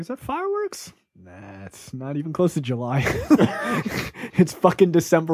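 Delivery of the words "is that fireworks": high, softer wondering question; mock puzzled question. Hearing gunshots at night, high puzzled. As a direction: play dumb about the noise.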